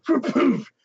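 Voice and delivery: 공이 글러브에 꽂히는 소리 흉내; 퍽 퍽 짧게 끊어서; imitated ball thuds. Imitating ball hitting glove.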